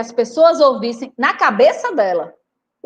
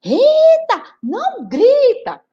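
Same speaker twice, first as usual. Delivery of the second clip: high, loud exasperated mother; tired mother snapping; 12 semitones higher, 4 dB louder, much slower than usual. Acted. Worn-out mother yelling back at Rita, higher and louder. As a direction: lose patience with the child. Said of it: "a mãe dela já cansada".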